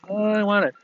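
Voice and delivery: put-on high, whining little kid grabbing a toy; whiny child. Imitating a child crying over a toy, high and whiny. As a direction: mimic a crying child who wants the toy.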